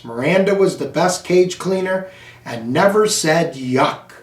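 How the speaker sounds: plain reading voice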